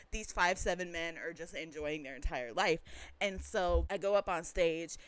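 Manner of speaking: ordinary delivery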